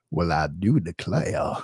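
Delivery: slow, very low Southern drawl; Southern accent. Shown as a demonstration. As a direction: draw it out in a deep, put-on Southern accent. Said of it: "my Southern accent".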